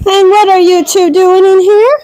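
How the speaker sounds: high-pitched scolding questions; scolding animals. Real, not acted. Owner catching two animals in the grain, high scolding.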